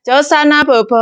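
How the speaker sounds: high, bouncy bedtime call; sing-song coaxing. Shown as a demonstration. Maid coaxing a child to nap, high and bouncy. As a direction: coax the child up in pitch. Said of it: "Nadanya lebih enak".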